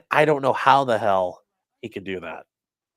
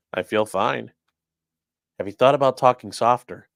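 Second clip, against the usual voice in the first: usual speaking voice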